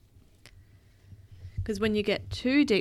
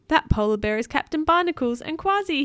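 high, louder happy cheer; 7 semitones higher, 7 dB louder than usual. A narrator's reading. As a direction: announce the rescuers with excitement. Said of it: "he cheered".

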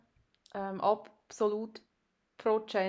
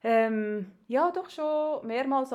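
ordinary talk, nothing marked

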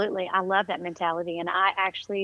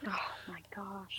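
whispered, sympathetic gasp of words; barely audible, stunned whisper; hushed shock; 16 dB quieter than usual. Real, not acted. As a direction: breathe it out in quiet dismay. Listener reacts to news of strokes.

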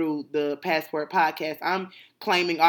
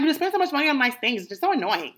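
higher, fast mock sister voice; 7 semitones higher, faster than usual.

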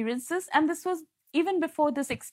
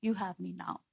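soft reassurance; 5 semitones lower, 7 dB quieter than usual. Retold or imitated. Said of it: "he put his hand on my head".

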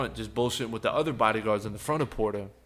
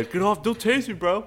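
high-pitched retold plea; higher, hammed-up alarm; mock panicked protest; 9 semitones higher than usual. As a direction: imitate yourself protesting as you're manhandled. Acts out protest while being thrown out.

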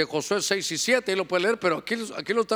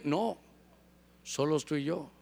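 más suave, seductora; voz insinuante baja; 5 semitones lower, 7 dB quieter than usual. Acted. Jefe seduce, acted quote. (el jefe tienta a la empleada, voz suave)

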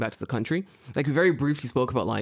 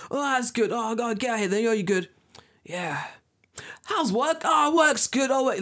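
high-pitched, hammed-up street chat called up to the balcony; mock shouting match; 7 semitones higher than usual. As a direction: play both sides of a mock shouting chat, much higher. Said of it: "basically shouting match".

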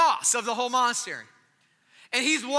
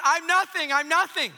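higher, louder mock cry of devotion; 6 semitones higher, 5 dB louder than usual. Janitorial monk at the altar, mock crying out. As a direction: cry it out as an imitated overwhelmed monk. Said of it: "he starts crying out".

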